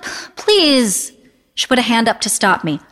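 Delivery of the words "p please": high, drawn-out begging; exasperated plea. A narrator's reading. Daughter begs mother not to dance, exasperated. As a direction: beg her to stop, pitched up.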